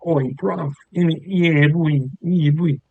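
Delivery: hammed-up British street slang voice; mock British roadman. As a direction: shout it like a bruv-saying British lad. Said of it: "British people sound like".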